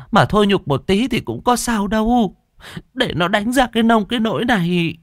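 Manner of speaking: high, fast, fretful female voice; fussing woman. Acted. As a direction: cluck over him like a worried neighbour woman. Yến fussing over beaten man. (giọng đàn bà xuýt xoa)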